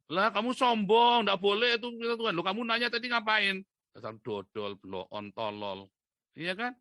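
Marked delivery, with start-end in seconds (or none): 0.1-3.6 high, loud mock quarrel; indignant accusation. Acting out a quarrel with an asker, loud indignation. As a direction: act out an indignant retort, voice raised. Acted quarrel over answering a question.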